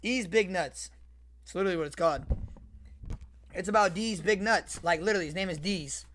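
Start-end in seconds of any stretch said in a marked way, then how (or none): none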